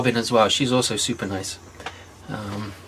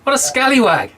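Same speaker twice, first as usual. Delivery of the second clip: high-pitched playful scolding; amused, high-voiced tut-tutting; mock indignation; 12 semitones higher than usual. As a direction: exclaim in pretend outrage, on the edge of laughter. Host mock-scolds the prankster cameraman.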